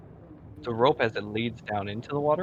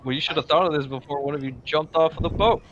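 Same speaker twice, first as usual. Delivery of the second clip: fast, loud, higher-pitched rebuke; angry scolding; 5 semitones higher, 7 dB louder, faster than usual. Acted. Captain blaming the party for the diver jumping off, angry scolding. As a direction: scold them loudly in a quick rush.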